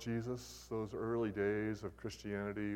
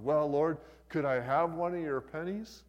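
higher, louder, mustered-up boldness; gathering himself up; 5 semitones higher, 7 dB louder than usual. Acted. Man asking the Lord for a penny, gathering himself up. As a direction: pluck up nerve and ask, rising at the end. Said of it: "Man gathers himself all up".

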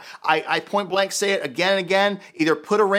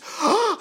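mock gasp; 12 semitones higher than usual. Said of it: "becomes mortified".

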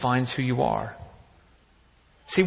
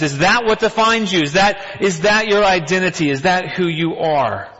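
higher, louder pulpit questions pressed at the crowd; rising preacher's fervor; 4 semitones higher, 5 dB louder than usual. Acted. Preacher pressing the congregation about identity, impassioned. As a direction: preach it harder with each question. Preacher building to full force.

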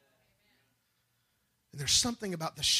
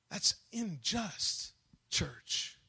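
slow rebuke; 3 semitones higher, 3 dB quieter, much slower than usual. Real, not acted.

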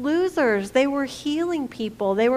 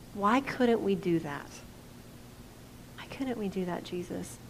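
humble question; 4 semitones lower, 9 dB quieter than usual. Retold or imitated. Disciples ask Jesus quietly.

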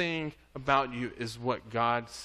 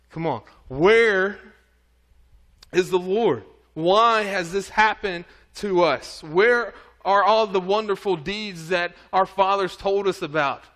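louder and higher, a desperate protest; exasperated complaint; 4 semitones higher, 6 dB louder than usual. Acted. Gideon protesting to the angel, louder and higher in exasperation. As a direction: throw Gideon's complaint up at heaven, pleading and frustrated.

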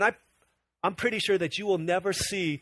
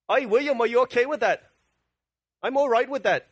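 put-on jokey Scottish accent, lifted in pitch; mock Scottish accent; 3 semitones higher than usual. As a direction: play two complacent Scots in an amateur accent. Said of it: "I get my accents mixed up".

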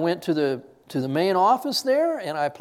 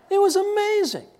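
high, louder exclamation of wonder; preacher marveling at the job, high and louder; amazed; 14 semitones higher, 5 dB louder than usual. Real, not acted. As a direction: let your voice jump with amazement. Marveling at God's provision.

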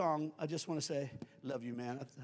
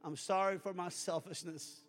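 leading a prayer. Acted. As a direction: pray it gently for them to repeat. Leads a sinner's prayer.

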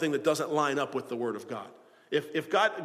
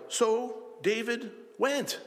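slow, weighty reading of "So David went" with the word "went" drawn out; deliberate verse reading, "went" stretched and stressed; slow scripture reading; 7 semitones higher, much slower than usual. Shown as a demonstration. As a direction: read "So David went" slowly, leaning on "went". Preacher reads verse nine aloud.

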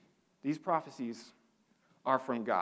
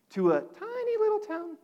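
squeaky tiny voice; 5 dB louder than usual. Acted. Preacher stresses tiny town.

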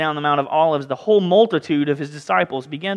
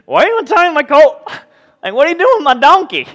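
high, loud protest at strangers untying his colt; outraged owner, pitched up and loud; indignant owner; 8 semitones higher, 6 dB louder than usual. Acted. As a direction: bristle like someone whose donkey is being taken. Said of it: "obviously its owner said".

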